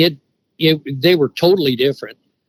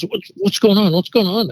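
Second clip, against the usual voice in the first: lightly acted, higher-pitched urgent question; mildly alarmed retold question, pitched up; 7 semitones higher than usual. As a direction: imitate his son arriving in a hurry. Voicing son running down, puzzled.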